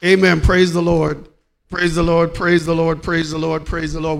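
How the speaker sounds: loud repeated praise calls, fading. Acted. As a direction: call the church to praise, then ease off. Funeral preacher leads praise.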